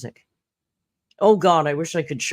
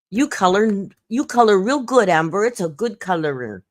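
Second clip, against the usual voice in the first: sarcastic you color real good, Amber; mock praise; 5 semitones higher, 4 dB louder than usual. Real, not acted.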